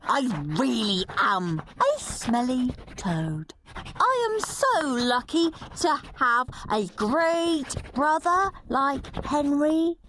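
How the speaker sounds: a hint of a cheeky schoolboy, slow and gleeful; a touch of a mischievous big brother; lightly voiced naughty boy. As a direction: relish every rude word like a scamp. Henry's gleeful rewrite.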